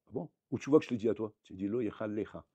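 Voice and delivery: normal talking voice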